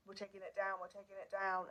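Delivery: ordinary talk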